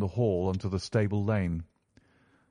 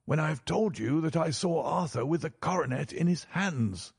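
higher-pitched banker, impatient; exasperated banker in a raised pitch; impatient cry; 8 semitones higher than usual. A narrator's reading. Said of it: "cried the banker impatiently".

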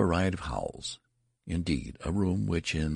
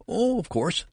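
high-pitched hysterical outburst; hysterical cry; 3 dB louder than usual. A narrator's reading. Terrified nurse answering the father, hysterical. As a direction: cry it out in a fluster, voice leaping up. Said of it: "she cried hysterically".

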